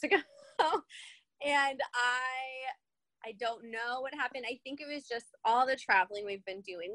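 ordinary talk

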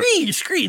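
high raptor screech; dinosaur screech. Pretend. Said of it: "that one's fine".

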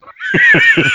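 high squealing laugh; real laughter bursting out. Real, not acted. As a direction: break into a high, loud laugh. Cracking up at own story.

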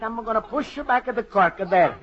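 normal talking voice

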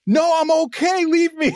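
high, loud shout refusing help; yelling back. Retold or imitated. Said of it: "And I'm yelling".

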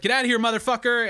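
mock yell. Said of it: "and I was like".

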